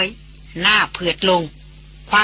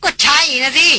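higher, sharp and clipped retort; curt snap; 7 semitones higher than usual. A narrator's reading. Chayan retorting bitterly to Anucha, snapping. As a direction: bite the answer off. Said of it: "ชายันกระแทกเสียง".

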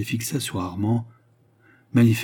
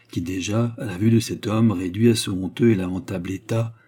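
ordinary delivery

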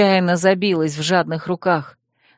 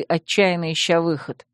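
ordinary delivery

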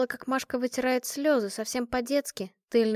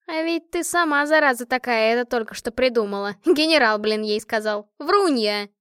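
teasing accusation; 2 semitones higher, 7 dB louder than usual. A narrator's reading. Машка teasingly accuses Натка of lying.

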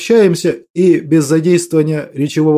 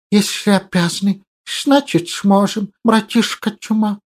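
hissing creature voice; 3 semitones higher, 3 dB quieter than usual. Acted. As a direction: lisp through the teeth. Hissing creature sidekick speaks.

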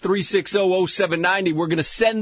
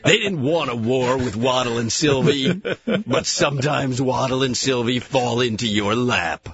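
movie trailer voice; 7 semitones lower than usual. Acted. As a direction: sell it like an epic trailer. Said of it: "make it sound like a movie trailer".

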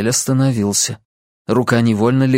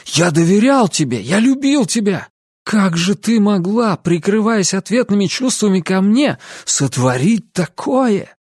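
higher, impassioned reproach; accusing cry; 8 semitones higher than usual. A narrator's reading. Ansel reproaching Lucy who he loved, accusing cry. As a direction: fling the accusation at her, pitch raised. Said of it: "обличительно закричал он".

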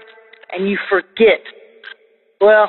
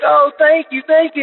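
mock tearful thank-yous, pitched higher; imitating tearful thanks; 7 semitones higher than usual. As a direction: voice the brother's crying gratitude, lifted high. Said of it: "he just starts crying".

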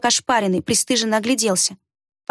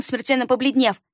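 plain reading voice

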